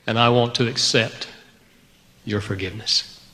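hushed repentant prayer to God; humble prayer. Retold or imitated. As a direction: pray quietly, humbled.

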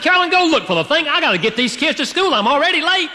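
husband yelling at wife about the briefcase; retold yelling. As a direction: bark orders while running late. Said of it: "I was screaming at my wife".

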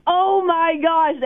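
high, drawn-out awe; stretched-out fangirl amazement; secret fangirling. Retold or imitated. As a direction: retell a starstruck gasp, slowly. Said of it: "fangirling secretly inside".

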